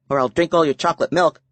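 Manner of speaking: a kid teasing with a fake threat; playful young girl. A narrator's reading.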